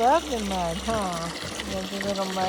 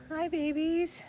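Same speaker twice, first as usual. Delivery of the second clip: talking to animals; 8 semitones higher, slower than usual. Real, not acted. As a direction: coo at the animals. Greeting animals high.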